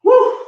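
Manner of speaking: high, loud whoop of energy; excited whoop. Real, not acted. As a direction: let out a big hype whoop. Workout hype after grabbing weights.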